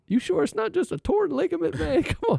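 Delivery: very high, disbelieving voice with a laugh; incredulous pleading. Retold or imitated. Patient doubting the therapist's diagnosis, high incredulous pleading. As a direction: plead like you can't believe the news.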